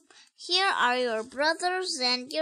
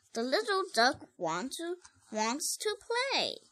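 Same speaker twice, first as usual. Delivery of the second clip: ordinary delivery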